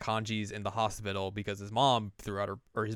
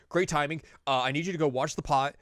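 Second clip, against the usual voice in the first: hurried instructions; 4 semitones higher, 6 dB louder than usual. Retold or imitated. Yu leaving Yukiko to watch the pot, bossy. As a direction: give orders in a rush. Yu rushing orders.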